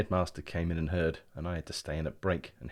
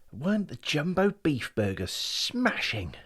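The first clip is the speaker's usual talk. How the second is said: slow, dreamy, near-whispered and higher; contented, reflective voice; 8 semitones higher, 4 dB louder, slower than usual. Acted. Brian remembering the burgers to his brother, contented and hushed. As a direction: savour the memory, hushed. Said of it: "in a sort of contented, reflective voice".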